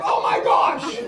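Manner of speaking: high, mock-panicked gasp; imitated horror. Passengers react to the pilot, imitated horror. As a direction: play horrified passengers up high. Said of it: "And they're like".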